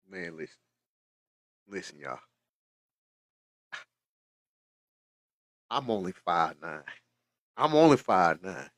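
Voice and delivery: moved to tears, speaking softly; choked up. Real, not acted. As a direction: still reeling, words coming out low. Still emotional after son's message.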